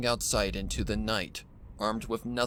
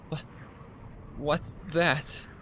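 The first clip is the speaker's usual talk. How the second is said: nervous question; 6 semitones higher, slower than usual. Acted. Spots a figure.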